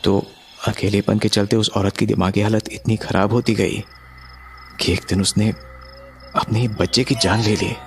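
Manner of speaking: plain reading voice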